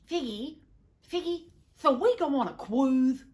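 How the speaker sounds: high, excited husband with a lisp; eager childish husband. Acted. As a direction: pipe up loudly with a babyish suggestion. Narrator voices eager husband suggesting cruise.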